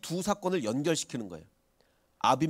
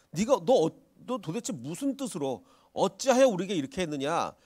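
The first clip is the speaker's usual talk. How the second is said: exasperated accusation; 3 semitones higher, 4 dB louder than usual. Retold or imitated. Abimelech confronts Abraham in disbelief. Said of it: "정말 너무 황당한 거예요".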